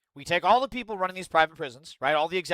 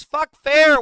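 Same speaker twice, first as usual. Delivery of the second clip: loud, high outburst; angry shout; 12 semitones higher, 14 dB louder than usual. Real, not acted. Streamer ranting at fairness advocates, loud and high. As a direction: spit it out in rage.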